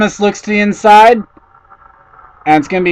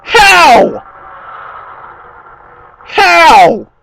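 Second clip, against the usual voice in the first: disbelief; 10 semitones higher, slower than usual. Real, not acted. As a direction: shout in disbelief. Cars still rubbing live.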